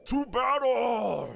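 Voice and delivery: slow rallying shout, pitched high; battle cry. Acted. Brother rallying the army for war, a slow high battle cry. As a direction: roar them into battle.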